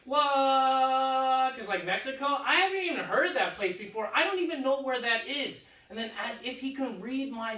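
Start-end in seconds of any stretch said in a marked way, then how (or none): 0.1-1.6 long, loud disbelieving what; stunned what. Retold or imitated. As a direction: let the what stretch out in shock.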